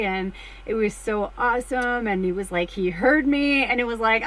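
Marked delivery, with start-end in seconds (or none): none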